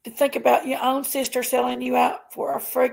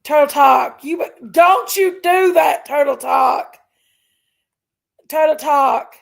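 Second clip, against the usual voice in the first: loud pleading call to a viewer; 3 semitones higher, 8 dB louder than usual. Real, not acted. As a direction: shout her name to stop her. Calling out to a donating viewer.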